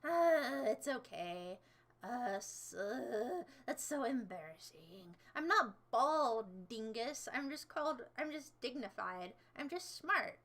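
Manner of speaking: normal talking voice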